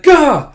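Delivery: loud, high flustered yelp; startled gah. Retold or imitated. Boy caught out about the hanky, loud high yelp. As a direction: yelp it in embarrassment. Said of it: "He's like".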